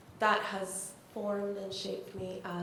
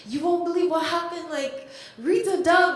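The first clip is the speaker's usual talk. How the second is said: fast, higher, louder excited retelling; excited quote; 8 semitones higher, 10 dB louder than usual. Retold or imitated. Telling her family about the call, fast loud and excited. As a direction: blurt it out breathless with news.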